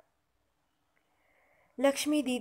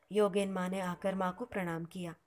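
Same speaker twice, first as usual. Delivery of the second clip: usual speaking voice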